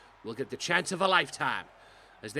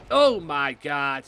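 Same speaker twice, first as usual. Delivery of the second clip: loud, slow shocked gasp of words; stunned by the move, drawn out; 10 dB louder, much slower than usual. Real, not acted. Big move lands.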